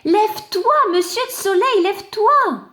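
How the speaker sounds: high, loud wake-up call. Acted. Clod calling the sun to get up, high and loud. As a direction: shout up for the sun to rise.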